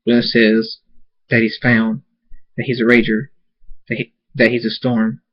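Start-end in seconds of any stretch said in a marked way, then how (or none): none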